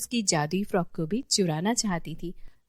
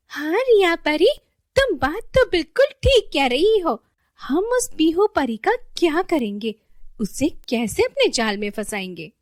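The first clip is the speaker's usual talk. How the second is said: loud, high conspiring fairy; high-pitched villainess hatching a plot; scheming villain fairy; 8 semitones higher, 7 dB louder than usual. Acted. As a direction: agree eagerly as a wicked accomplice. Second villain fairy agrees, plots.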